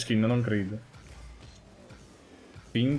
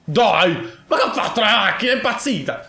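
excited shout; 10 semitones higher, 6 dB louder than usual. Real, not acted. Car glitches mid-game.

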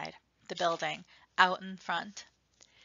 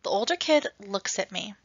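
ordinary delivery

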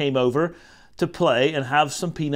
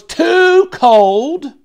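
"too" high, loud and drawn out; complaining stress; 13 semitones higher, 9 dB louder than usual. A narrator's reading. Child complaining about bath.

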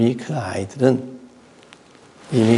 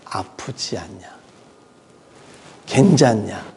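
caring mother; 4 semitones lower, slower than usual. Retold or imitated. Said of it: "엄마는 먼저 뭘 물어보냐면".

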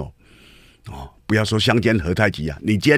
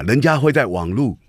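ordinary delivery

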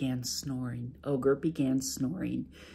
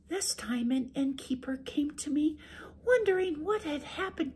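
very high, soft, sweet wife; high sweet wife making excuses; 13 semitones higher, 3 dB quieter than usual. Acted. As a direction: play innocent to the ogre, high and gentle. Wife tells last dream.

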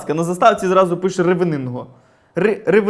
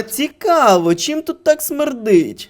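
very slow, raised-pitch feigned wondering; mock puzzlement; 4 semitones higher, much slower than usual. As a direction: ponder it innocently, dragging the words out. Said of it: "і казати".

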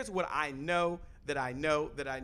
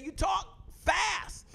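mocking critic; 12 semitones higher, much slower than usual. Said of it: "And they say".

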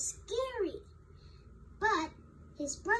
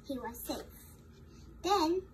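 plain reading voice

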